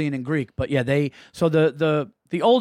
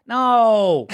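loud, higher-pitched disapproving "no"; disgusted dad's refusal; 5 semitones higher, 5 dB louder than usual. Retold or imitated. Son imitates dad at the tour table, loud disgusted refusal. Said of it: "sucked his teeth so loud and was like".

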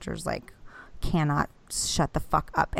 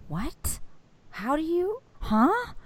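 rising much higher, stunned; baffled disbelief; 11 semitones higher, 4 dB quieter, slower than usual. Retold or imitated. Wife stunned as husband shows the keys, high baffled. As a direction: sound completely thrown. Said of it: "And I'm like".

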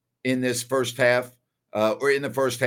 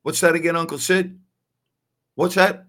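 higher-pitched, puzzled asking; retold plea to say it once more; asking to repeat; 5 semitones higher, 3 dB louder than usual. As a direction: call out a baffled question, pitched up. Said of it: "I had to go".

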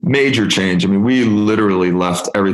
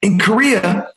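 much higher, emphatic voice for the colleague; a colleague setting him straight, pitched up high; 11 semitones higher than usual. Retold or imitated. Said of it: "He's like".

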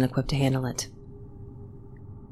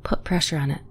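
ordinary delivery, nothing marked

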